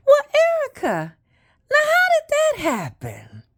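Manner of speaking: mother's hesitant question, partly pitched high; imitated mother. Mother answering daughter's pregnancy news, imitated hesitant voice. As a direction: imitate a mother pausing before she asks. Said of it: "she paused and she said".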